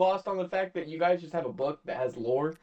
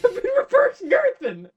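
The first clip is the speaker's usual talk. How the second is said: high squeaky laughing repeat; 3 dB louder, slower than usual. Retold or imitated. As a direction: repeat it cracking up. Repeats joke while laughing.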